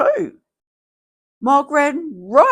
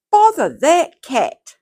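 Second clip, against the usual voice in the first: louder annoyed bother; cross father; 7 semitones higher, 7 dB louder than usual. Acted. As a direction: snap at the cat, exasperated. Father scolds the cat.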